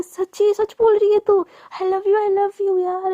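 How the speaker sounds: slow thrilled squeal of happiness; overjoyed. Retold or imitated. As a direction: burst out with delight at the news. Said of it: "सच में एकदम बहुत ज़्यादा खुश".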